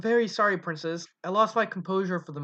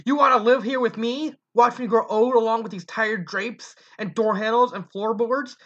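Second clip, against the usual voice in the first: angry berating; 6 semitones higher, 5 dB louder than usual. Acted. Mother berating her son.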